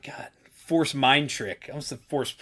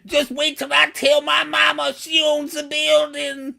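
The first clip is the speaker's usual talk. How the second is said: loud, high, outraged alien gangster; Zero the Hutt; 8 dB louder than usual. Acted. Threatening club staff with mama, loud high Hutt voice. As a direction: squeal the threat at full volume. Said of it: "and Zero the Hutt".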